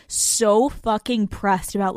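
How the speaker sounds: usual speaking voice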